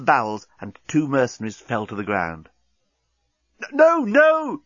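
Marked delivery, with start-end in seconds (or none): 3.7-4.6 high, loud panicked shout; alarmed yell. Acted. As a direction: cry out in alarm. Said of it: "yelled Corporal Inchby Wiglet in alarm".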